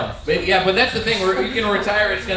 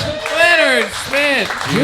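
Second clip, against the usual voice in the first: announcer call; 10 semitones higher, 4 dB louder, slower than usual. Acted. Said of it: "host announces comic".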